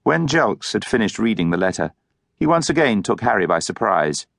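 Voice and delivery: ordinary delivery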